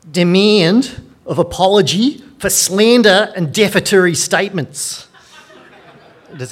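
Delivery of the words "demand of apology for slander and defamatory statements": hammed-up Australian lawyer voice, much higher and louder; mock Australian accent. Shown as a demonstration. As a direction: read it like an over-the-top Australian solicitor. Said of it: "I'll read it in my best Australian accent".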